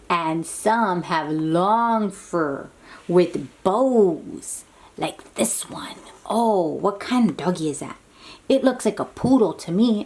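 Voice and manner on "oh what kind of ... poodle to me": ordinary delivery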